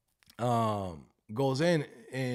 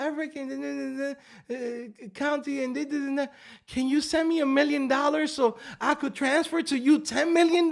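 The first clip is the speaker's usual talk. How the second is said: mock scam princess; 7 semitones higher than usual. Comedian mimicking a scam email princess, high and coy. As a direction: play the fake princess begging for money.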